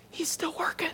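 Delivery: hushed, breathy aside; whisper. Acted. Preacher to congregation, soft whisper. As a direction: whisper it like a secret.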